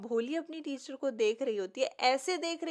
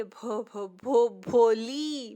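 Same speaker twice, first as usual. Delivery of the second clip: stammering out the name; 3 semitones lower, 5 dB louder, much slower than usual. Acted. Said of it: "वो finally बोल देती है".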